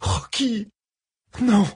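high desperate cry of a name; anguished cry. Acted. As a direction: cry out in panic. Friend lies still.

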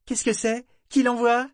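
bubbling over with curiosity; eager girl. A narrator's reading. Girl grabbing for her surprise gift.

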